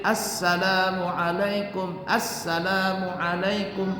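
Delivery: Assalamualaikum with the double s held long; correct salam. Shown as a demonstration. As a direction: chant it slowly with the doubled s. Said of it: "Salam itu kan pakai tasydid".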